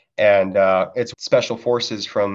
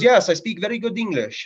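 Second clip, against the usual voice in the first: high, eager shopkeeper voice; imitating the shop clerk; 10 semitones higher than usual. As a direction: play the bazaar clerk boasting proudly. Bazaar clerk voiced in story.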